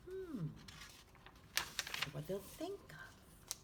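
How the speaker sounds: put-on hushed whisper to the kids. Teacher pointing out the jellyfish to children, hushed whisper. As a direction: whisper it like sharing a secret.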